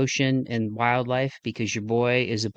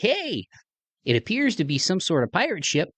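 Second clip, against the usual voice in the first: a plain Midwestern reporter, pitched up on the Hey; Midwest accent; 8 semitones higher than usual. Shown as a demonstration. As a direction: deliver it as a Midwest guy would, flat and friendly. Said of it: "a guy like me on there with a Midwest accent".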